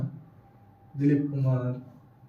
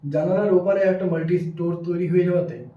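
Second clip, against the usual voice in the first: usual speaking voice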